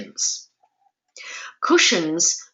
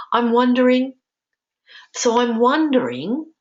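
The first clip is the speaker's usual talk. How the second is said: higher, lilting musing on the phrase; curious wondering; 7 semitones higher, 4 dB louder than usual. Shown as a demonstration. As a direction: model a tentative, curious opener in a raised pitch. Models a curious cushion phrase.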